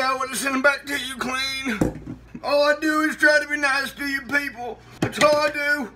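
high-pitched, self-pitying wail; hurt whining. Acted. Host pleading with ungrateful people, high hurt whine.

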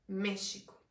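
"México" with the x said as "sh": "Méshico". Shown as a demonstration. Teacher showing how Portuguese speakers say "México" with sh. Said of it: "la X suena como una sh".

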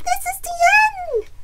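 very high, fast, loud squawk; squawking chicken. A narrator's reading. Chicken cornered by Bear, high panicked squawk. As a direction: squeal it like a doomed hen. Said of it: "Chicken squawked".

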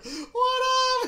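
very high, loud mock holler; mimicked yell. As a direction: yell it out as a hammed-up greeting. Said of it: "And she's yelling at him".

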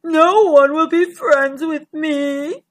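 slow, self-pitying giant voice; woeful, drawn-out creature voice; Gigantosaurus. A narrator's reading. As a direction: lament like a lonely, sniffly dinosaur. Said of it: "She sniffed loudly".

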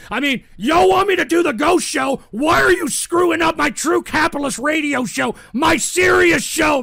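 worked-up shouting at the trolls; indignant rant. Real, not acted. Host protests show being ruined.

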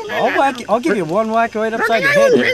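usual speaking voice

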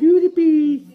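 high, loud sing-out of the name; PewDiePie. Acted. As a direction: imitate the squealed channel name. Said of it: "Say PewDiePie this time".

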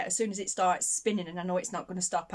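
normal talking voice

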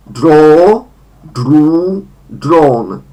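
draw, drew, drawn said loud with long vowels; English verb forms. Shown as a demonstration. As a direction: model the three forms clearly. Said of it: "wydłużone o". (głośne draw, drew, drawn z długimi samogłoskami)

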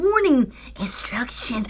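whispered, hushed mock warning. Playfully starting the instruction segment, a whisper. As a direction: breathe it like a secret announcement.

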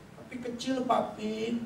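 put-on small, soft child voice; daughter's downcast reply. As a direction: confess it softly, head down. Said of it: "Kemudian dia nunduk".

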